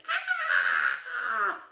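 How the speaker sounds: loud high scream; shouted cry of fright; hollered scream. A narrator's reading. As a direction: holler it out in terror. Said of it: "I screamed, I hollered".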